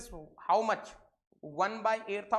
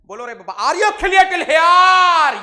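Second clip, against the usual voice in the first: high, loud rallying shout to the class; loud call-out; 8 semitones higher, 18 dB louder, slower than usual. Real, not acted. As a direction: rouse the whole class with a booming call. Teacher calls out to class. (पूरी class को ज़ोर से पुकारते हुए)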